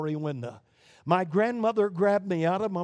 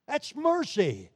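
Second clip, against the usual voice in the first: ordinary delivery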